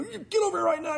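quick high-pitched summons; acted exasperated order; mock scolding parent. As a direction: imitate a parent calling the kids over urgently. Acts parent calling own kids.

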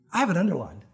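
normal talking voice

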